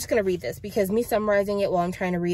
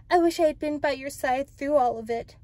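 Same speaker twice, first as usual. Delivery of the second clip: higher-pitched regretful character voice; acted earnest apology, pitched up; Rachel; 7 semitones higher than usual. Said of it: "feeling the full brunt of my regret".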